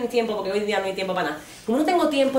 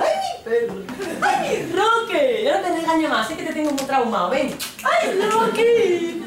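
put-on baby talk to dog; 9 semitones higher than usual. Comedian coos to her scolded dog, high cooing baby talk. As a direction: coo sweetly to a pet you feel bad for.

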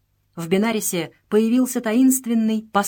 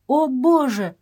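higher, louder mild tearful cry; 6 semitones higher, 5 dB louder, slower than usual. A narrator's reading. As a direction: lament without real sobs. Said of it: "плакала Эва".

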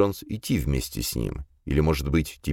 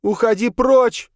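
high, loud frantic yell; high-pitched banishing shout; crying out; 6 dB louder, slower than usual. A narrator's reading. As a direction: shriek it to drive the ghost off. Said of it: "продолжала выкрикивать Долорес О'Тул".

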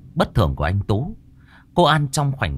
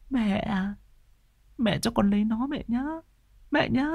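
put-on much higher, softer, babyish whine on "mẹ"; whiny childlike pleading; 12 semitones higher, 5 dB quieter than usual. Dazed son whining to his mother, babyish and high. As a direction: wheedle like a small child. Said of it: "nửa tỉnh nửa mê".

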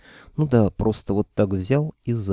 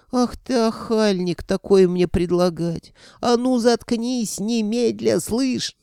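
very high, drawn-out indignant voice; scandalised scolding; 13 semitones higher, slower than usual. A narrator's reading. Sorcerer's other self outraged at the proposal, scolding shut-up. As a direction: scold in a high-pitched put-on voice, stretching words.

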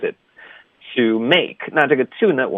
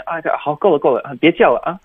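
put-on higher, patronising hushing of a child; condescending; 5 semitones higher, faster than usual. Grown-up telling someone to stop fussing, condescending. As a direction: talk down as if shushing someone small.

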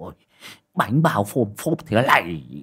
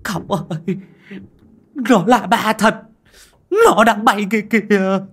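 much higher, frightened pleading; panicked fear; 11 semitones higher, 6 dB louder than usual. A narrator's reading. Driver seeing ghost's floating feet, begging master in fear. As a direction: beg your master in terror.

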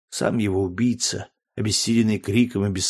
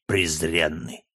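low, softer contempt; contemptuous anger; 5 semitones lower, 4 dB quieter, slower than usual. A narrator's reading. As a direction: drop low on the insult in cold anger. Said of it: "пылая гневом". (презрительно, понизив голос)

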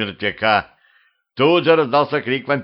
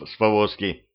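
usual speaking voice

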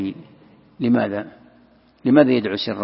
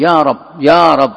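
imploring call to God; 2 semitones higher, 6 dB louder than usual. Retold or imitated. Voicing a supplicant calling to God.

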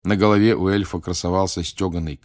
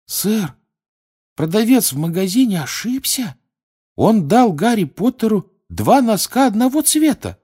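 squeaky elf voice; 14 semitones higher, 5 dB louder than usual. Acted. Dobby voiced.

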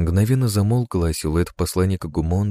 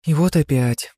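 excited whisper; 9 semitones higher than usual. A narrator's reading. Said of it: "взволнованно зашептала Яни".

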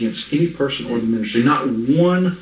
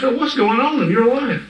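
high, slow acted demand; in-your-face confrontation; 12 semitones higher than usual. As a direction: confront him, drawing the question out high. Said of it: "get right in his face and go".

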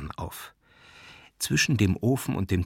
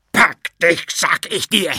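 high, loud, distorted command; furious shout; 8 semitones higher, 7 dB louder than usual. A narrator's reading. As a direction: roar it as an eviction order. Jakow orders him out in rage. (zornig brüllen)

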